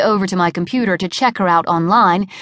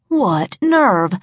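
ordinary delivery

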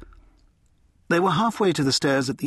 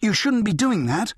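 shocked, raised pitch protest; 9 semitones higher than usual. Acted. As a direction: object in startled disbelief.

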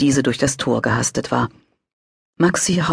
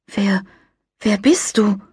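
frightened; 7 semitones higher, slower than usual. A narrator's reading. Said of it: "Maxi fürchtete sich".

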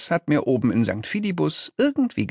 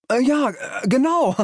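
high, stammering agreement; squeaky hesitant underling; nervous yes-man. A narrator's reading. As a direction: chime in eagerly but flustered. Second nervous demon chimes in.

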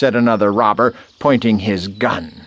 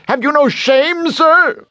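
very high scolding cry; tiny creature loudly shaming a villain; indignant yell; 4 dB louder than usual. A narrator's reading. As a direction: shriek your moral outrage. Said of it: "the bone yelled".

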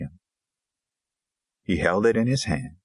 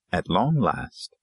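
usual speaking voice

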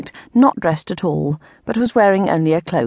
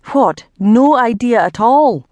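slow, incredulous irritated question; 3 semitones higher, 4 dB louder, slower than usual. A narrator's reading. Earl hearing the ghost has no news, irritated. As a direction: draw it out in exasperated disbelief. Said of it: "Lord Macon's irritation".

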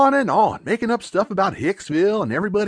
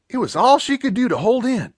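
ordinary delivery

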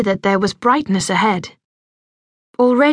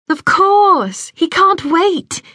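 excited; 8 semitones higher, much slower than usual. A narrator's reading. Stella reassures eager little brother.

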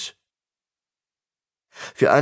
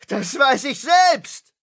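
high, drawn-out shriek; furious scream; 13 semitones higher, slower than usual. A narrator's reading. Tristan rages at the priests, screaming. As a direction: scream it slowly, pitch way up. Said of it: "schrie er".

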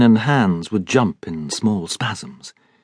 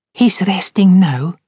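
higher, slow and tender; Scots maid; 10 semitones higher, 4 dB louder, slower than usual. A narrator's reading. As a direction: speak gently of the sleeper. Maid speaks of the sleeper.

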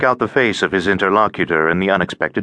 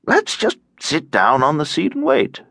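higher, blithely cheery voice; tiresome, cheerful; 8 semitones higher than usual. A narrator's reading. Stranger suggests waiting in the stuck lift, tiresomely cheerful. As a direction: ring out cheerfully, a bit of a bore. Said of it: "the tiresome, cheerful voice rang out".

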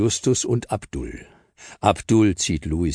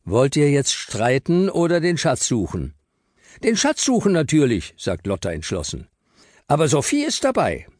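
put-on higher kid voices, firm and decided; kids settling a quarrel; 8 semitones higher than usual. Joshua and Lotta settle the argument, firm and high. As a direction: end the squabble and get going.

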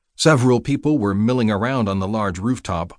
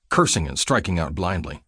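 usual speaking voice

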